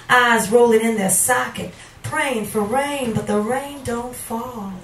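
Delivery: poetry reading. Acted. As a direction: swell on the warnings, then fall away. Reads a poem aloud.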